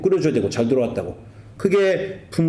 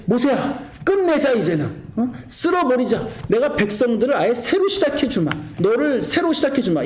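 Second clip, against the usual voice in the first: higher, exasperated voice of God; God declaring to Moses he is done, exasperated; 8 semitones higher than usual. Acted. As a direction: vent like a fed-up God ready to start over. God fed up speaking to Moses.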